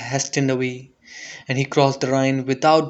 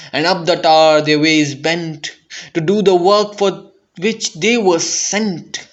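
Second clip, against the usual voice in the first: ordinary delivery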